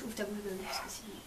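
soft whispered coaxing; whispering. Real, not acted. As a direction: whisper the instruction. Coaxing taster quietly.

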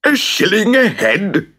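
mayor screeching at the stranger's price. Acted. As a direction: screech it in disbelief. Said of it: "screeched the mayor".

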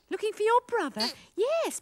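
usual speaking voice

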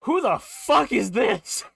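high, loud, slow disbelief; baffled yell at the screen; real stunned outburst; incredulous shout. Real, not acted. Streamer stunned by unknown Pokemon.

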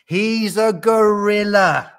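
high, loud, drawn-out reveal; excited proclamation. A narrator's reading. Gnu reveals who Gordon is, excited. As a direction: announce him with great fanfare.

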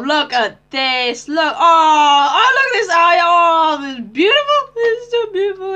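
loud, high cry of wonder; overjoyed, near tears. Real, not acted. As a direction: gush like you could weep with joy. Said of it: "I could cry".

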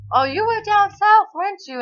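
high mock voice of teasing classmates. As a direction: toss it out like a nosy classmate. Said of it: "everybody's like".

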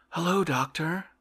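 exclaiming. A narrator's reading. Oblomov greeting the doctor at the door, exclaiming. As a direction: greet the guest with a bright exclaim. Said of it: "Oblomov exclaimed".

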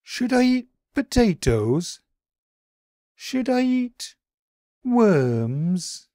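high, small puzzled bunny voice; a high, wondering little creature; Daily B's voice. A narrator's reading.

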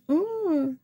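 high-pitched whiny kitty complaining; cat whining to its mom about a bitten tail. Pretend. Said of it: "poor little Beef's like".

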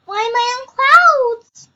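loud, high, startled question; loud bewilderment. Real, not acted. Player finding herself on clouds in the game, loudly bewildered. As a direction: cry out in bewilderment, voice climbing.

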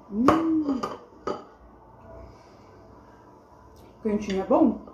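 talking while chewing cake; muffled words around food; mouth full, chewing. Real, not acted. Seen 2: Woman in blue chews with bulging cheeks right after a bite.

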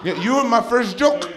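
high, loud imitation of a boastful mom; talking smack. Mom belittles her son's comedy career, high-pitched trash talk. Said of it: "she talks smack to me".

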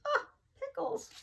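mock surprise. Teacher pretending to find pickles in the sink, squealing surprise. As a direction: act shocked at the sink full of pickles.

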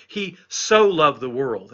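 slow down and hammer the word "so"; stress on SO. Shown as a demonstration. Preacher driving home what is being emphasized, strong stress on "so". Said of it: "It's being emphasized".

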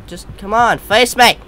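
high, loud challenge; defiant shout. Real, not acted. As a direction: yell out a dare. Challenging the unseen figure.

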